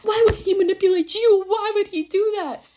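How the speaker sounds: very high, squeaky mock voice; hammed-up high-pitched meme voice; TikTok imitation. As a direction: wail it way up high, drawing out the end. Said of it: "reminded me of that TikTok".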